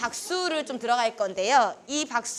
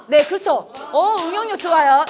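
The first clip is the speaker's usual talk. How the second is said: excited praise; 5 semitones higher, 9 dB louder than usual. Real, not acted. Praising group mid-game.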